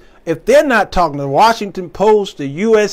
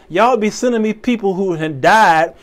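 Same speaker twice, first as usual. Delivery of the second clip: ordinary talk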